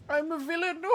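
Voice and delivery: high loud cackling villain parody. Pretend. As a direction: ham it up like a cartoon baddie. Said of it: "Villain voice".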